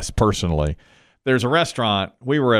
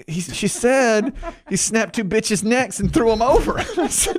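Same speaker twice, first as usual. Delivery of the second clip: laughing; 12 semitones higher than usual. Real, not acted. Laughing while retelling the mix-up.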